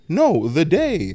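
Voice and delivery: put-on high, slow old lady insisting; high, drawn-out elderly correction. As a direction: stretch it out like a confused old woman. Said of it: "she said".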